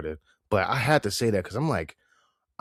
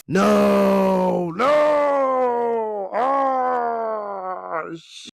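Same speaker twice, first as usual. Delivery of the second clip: high shouting no no as the phone dies; real dismayed shout; 10 semitones higher, 3 dB louder, much slower than usual. Real, not acted. Catching his phone dying mid-recording, a real dismayed shout. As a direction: cry out in real dismay, much higher and louder.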